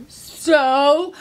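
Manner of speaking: drawn-out sassy "so". A narrator's reading. Lion answers back while terrified, sassy. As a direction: stretch the word with attitude. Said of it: "give me your sassy so".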